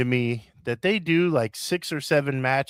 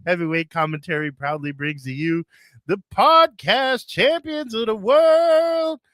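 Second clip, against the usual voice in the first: ringmaster; 6 semitones higher than usual. Acted. Announcer intro of hosts.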